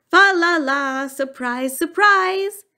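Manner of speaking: spring a happy surprise on someone; merry announcement. A narrator's reading. Husband brings tree home to wife.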